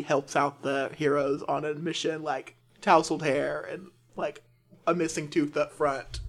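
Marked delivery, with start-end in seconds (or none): none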